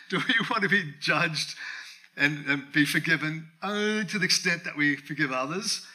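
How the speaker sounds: normal talking voice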